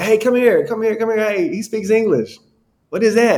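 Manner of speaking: high, eager village shouts of come here; calling the neighbours over, all worked up; excited calling out. Retold or imitated.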